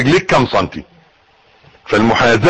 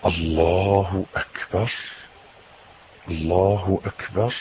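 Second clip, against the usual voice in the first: much softer, lower and slow, inward; devout whispered takbir; 9 semitones lower, 11 dB quieter, much slower than usual. Shown as a demonstration. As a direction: feel each takbir in a near whisper. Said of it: "واستشعر بقى وانا بقول".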